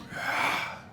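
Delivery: breathy exhaled whoosh, no voice; long unvoiced sigh of escaping magic; whispery hiss. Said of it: "Why did it whisper?".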